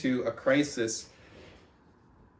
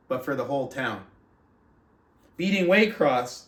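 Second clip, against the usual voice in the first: steady, fuller read-aloud voice, a bit louder than the chat; book reading; 4 dB louder than usual. Acted. Podcast host reading a coaching memoir to his book club, in a steady read-aloud voice. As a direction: read the memoir passage out to the listeners.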